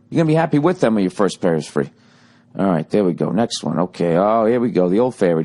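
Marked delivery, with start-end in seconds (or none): 0.1-1.9 rattled off fast; rushed disclaimer. Acted. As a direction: speed through it like fine print. Rushed legal-style repeat.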